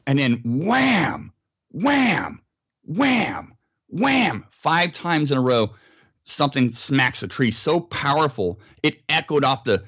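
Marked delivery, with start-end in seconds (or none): 0.0-4.5 each wham punched, climbing higher; imitated heavy wham blows. Retelling the night the tree got smacked, booming imitated whams. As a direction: hammer out the blows one by one.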